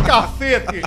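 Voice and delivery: exclama cacete bem alto; desabafo alto. Real, not acted. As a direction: solta o palavrão com força. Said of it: "Hoje eu tô pegado". (desabafo forte, exclamação alta)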